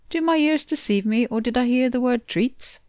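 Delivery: lightly voiced cat, hopeful and lilting; eager cat character. Cat sauntering in on hearing of treats, lightly eager. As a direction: perk up with mock-formal curiosity.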